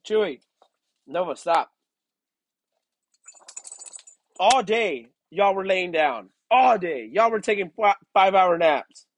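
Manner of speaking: loud, high-pitched complaint at the dogs; exasperated scolding. Real, not acted. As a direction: tell the dogs off, really fed up.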